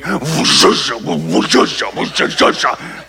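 breathy whooshing sloshing noise, slowing at the end; imitated dishwasher noise. Pastor mimicking the noisy old dishwasher, whooshing. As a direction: imitate a loud dishwasher churning. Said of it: "we're hearing".

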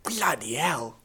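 hammed-up British exclamation; playful put-on British voice; British accent. Trading British accent impressions.

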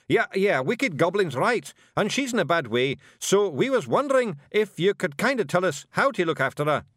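high, loud, hurried-along Scots agreement; Scottish Feegle, bluffing. A narrator's reading. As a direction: grab the prompt impatiently and bluster on. Voices Rob improvising.

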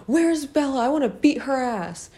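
high-pitched imitation of a raging yell; hammed-up high furious yell; mock screaming. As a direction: shriek it like a bully hunting someone. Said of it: "running into every class screaming".

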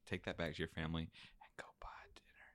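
quietly slipping him the money; hushed. Retold or imitated. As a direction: keep it low and secret. Retold tip, hushed aside.

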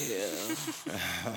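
a low, exaggerated imitation of a deep bass voice; mock deep voice. Host teasing guest by imitating his deep voice, low and exaggerated. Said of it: "The octaves went down".

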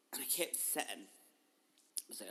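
normal talking voice

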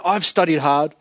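usual speaking voice

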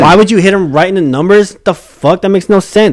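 exasperated objection. Real, not acted. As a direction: argue back, fed up. Argues back over the throw.

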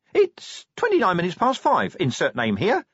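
high, small, nervous imp voice. A narrator's reading. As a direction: chirp it anxiously like a tiny machine. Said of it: "said the imp nervously".

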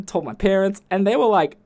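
ordinary talk

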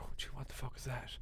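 fast, very soft, hushed; half-whispered so nobody hears; hushed alarm. Retold or imitated. Hiding in bed, noise outside.

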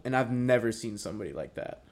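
normal talking voice

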